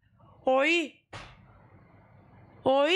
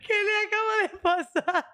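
stunned disbelief; 8 semitones higher than usual. Real, not acted. Repeats question, still stunned.

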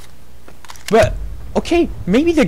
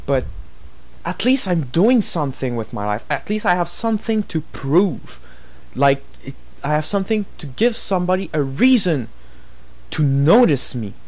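quiet, lower, deflated voice; dejected; 4 semitones lower, 5 dB quieter than usual. Real, not acted. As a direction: let the anger drain into disappointment. Calms down after the rant, dejected.

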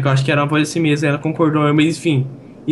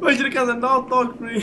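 laughing, much higher; 9 semitones higher than usual. Real, not acted. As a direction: call out through laughter. Reconhece famoso, rindo. (rindo ao chamar o famoso)

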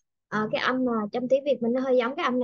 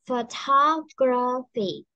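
Photography stretched out very slowly for the students to copy; slow model word; much slower than usual. Shown as a demonstration. Teacher slowly modelling Photography for repetition. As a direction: drag out Photography so the class can hear every sound. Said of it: "Âm này nè".

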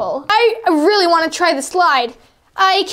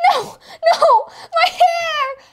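high wailing no; crying out; 11 semitones higher, much slower than usual. Acted. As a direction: wail in despair. Character wails about hair.

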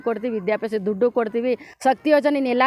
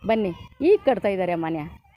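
ordinary delivery

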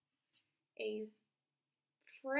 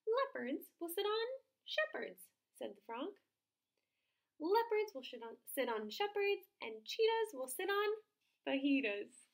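proud frog; 7 semitones higher, 6 dB louder than usual. Acted. Frog lists rhymes.

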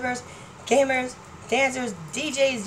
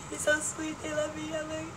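normal talking voice

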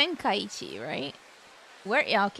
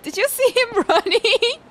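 laughing disbelief; 9 semitones higher, 4 dB louder than usual. Real, not acted. Laughing at fleeing pickpocket.

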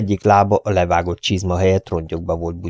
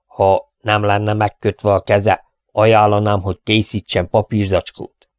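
plain reading voice

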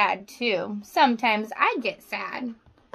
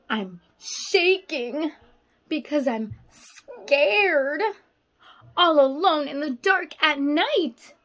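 slow, hushed read with little gasps; scared storybook reading. A narrator's reading. Teacher reading a feelings book to her class, scared and slow. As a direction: read the scared page like a frightened little kid. Reads scared page to children.

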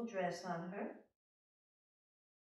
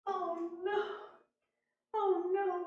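dismayed queen; 12 semitones higher, 5 dB louder, slower than usual. Acted. Queen despairs.

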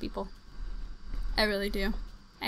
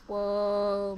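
normal talking voice

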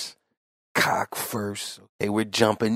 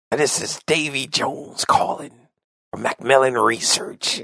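character voice; 5 semitones higher than usual. Retold or imitated. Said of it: "all these different characters".

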